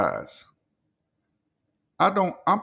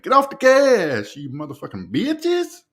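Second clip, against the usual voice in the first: own version, shouted; 6 semitones higher, 6 dB louder than usual. Shown as a demonstration. Streamer claiming the catchphrase as his own, yelling. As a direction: make it your own, shout it out. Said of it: "I might just make it my own by saying".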